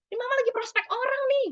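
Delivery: high, quick complaining mother; disapproving parent. Acted. Working mother to her crying child, high and rushed complaint. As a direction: act a hurried mum shooing the child off. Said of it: "ada lagi disapproving parent".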